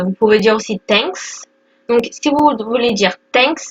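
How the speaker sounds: normal talking voice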